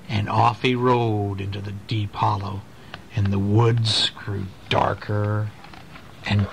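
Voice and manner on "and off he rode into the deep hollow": ordinary delivery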